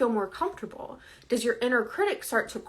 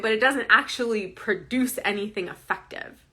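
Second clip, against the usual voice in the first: ordinary talk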